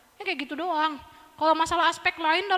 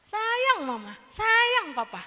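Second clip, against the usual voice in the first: put-on light, high childlike chant; a little child reciting affection; kid singsong; 6 semitones higher, 4 dB louder, much slower than usual. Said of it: "Kayak Upin Ipin ya". (anak kecil mengucap sayang dengan nada tinggi)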